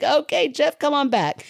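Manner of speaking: high, punchy mock-eager welcome. Host retelling her welcome to Jeff, high and eager. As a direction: play up how easily won over you were.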